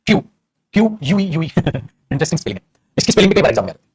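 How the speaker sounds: usual speaking voice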